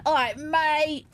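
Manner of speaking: drawn-out, high London "all right, mate"; London accent. Shown as a demonstration. Showing viewers a proper London accent, broad and drawn out. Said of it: "there's a proper, like, London accent".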